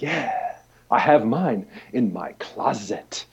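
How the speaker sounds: slow secret whisper; hushed whisper. Retold or imitated. Avery Brooks confides to the fan, slow whisper. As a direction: share it like a private secret, slowly.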